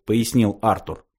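ordinary delivery

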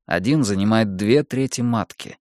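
ordinary delivery

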